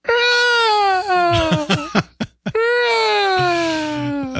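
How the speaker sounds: screeching seagull cry, very high; deadly seagull impression. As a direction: screech like a gull. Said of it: "nice seagull impersonation".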